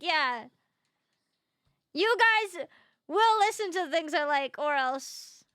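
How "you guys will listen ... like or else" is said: mock threat.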